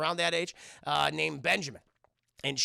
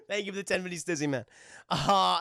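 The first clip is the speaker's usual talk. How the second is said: ordinary delivery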